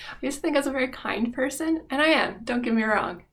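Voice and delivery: ordinary talk, nothing marked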